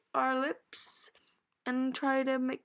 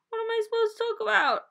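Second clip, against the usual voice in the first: fast, high, panicky; frantic; 10 semitones higher, 5 dB louder, much faster than usual. Real, not acted. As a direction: rush it in a mock panic. Left alone on camera.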